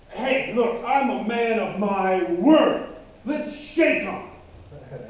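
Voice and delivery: loud and drawn out, sealing a deal; emphatic insistence. Acted. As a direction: insist loudly, stretching the words.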